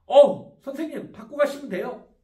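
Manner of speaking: a hint of a stern parent; firm, by the rules. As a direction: set the customer straight by the book. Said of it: "원칙적으로 얘기하겠죠".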